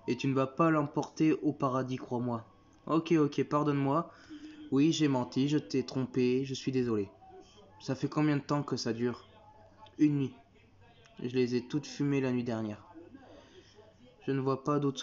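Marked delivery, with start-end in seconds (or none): none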